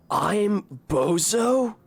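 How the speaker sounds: slow, drawn-out, baffled question; incredulous. Real, not acted. Streamer rereading an insult from the game, slow and incredulous. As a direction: repeat it like you can't believe the game called you that.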